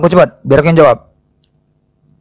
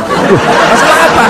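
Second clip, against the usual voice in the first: feigned innocence; 10 semitones higher than usual. Acted. Seller plays innocent.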